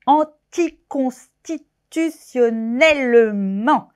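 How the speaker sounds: each syllable of "Intergouvernementalisation" and "Anticonstitutionnellement" spelt out slowly and clearly; slow careful long words. Shown as a demonstration. [name] practising long French words, said slowly. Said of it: "je m'entraîne à dire les mots les plus longs".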